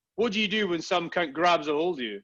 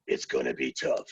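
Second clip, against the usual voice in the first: much lower, deep gravel-free growl of a tough Navy seal; motivational video voice; 4 dB quieter than usual. Retold or imitated. Jocko Willink in a motivational video, very low and steady. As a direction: growl it like a hard motivational speaker. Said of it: "he's the voice".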